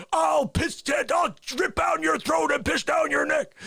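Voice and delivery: high, rushing angry shout; enraged threat. Acted. Arrested colonel lunging at the lawyer, furious shout. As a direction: lose control and spit out the threat.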